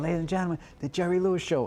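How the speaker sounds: mock big-band announcer intro; lightly voiced showbiz announcer. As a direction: announce it like an old TV host.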